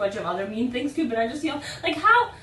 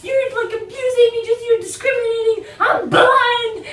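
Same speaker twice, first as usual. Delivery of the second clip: high, loud, wailing accusations; hammed-up imitation of an aggrieved passenger; outraged yelling; 10 semitones higher, 8 dB louder than usual. Lady accusing a blind man of abuse, mock-outraged yelling. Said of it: "started yelling at him".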